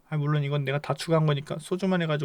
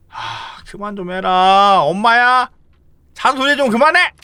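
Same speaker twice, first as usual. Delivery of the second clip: loud, higher stop-nagging shout; annoyed outburst; 6 semitones higher, 12 dB louder, slower than usual. Acted. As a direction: cut the nagging off, irritated. Character tired of friend's nagging. (잔소리에 짜증내며 크게 소리치기)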